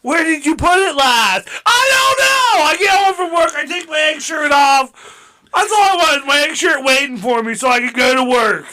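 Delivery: frantic yelling. Pretend. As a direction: yell like a stressed worker late. Frantic worker hunting his shirt.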